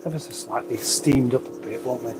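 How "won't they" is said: usual speaking voice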